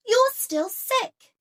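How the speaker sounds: put-on high, slow fretting girl's voice; worried Katy. A narrator's reading. Katy telling her sick cat, high and worried. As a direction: fuss over a sick pet. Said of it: "Katy said to her pet".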